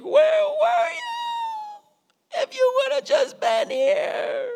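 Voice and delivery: grieving sisters crying out at Jesus; wailing cry. Retold or imitated. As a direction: wail it out in grief and reproach. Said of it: "I'm imagining they're like".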